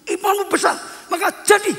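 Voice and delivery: high, firm declaration with falling ends; emphatic pronouncement. Retold or imitated. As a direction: proclaim it as Jesus rewarding faith. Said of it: "Yesus berkata". (sabda tegas bernada tinggi)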